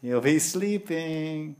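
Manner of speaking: sing-song sleepy drawl; mock sleepy. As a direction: stretch it out as if dozing off. Mocks bhajan done while fasting.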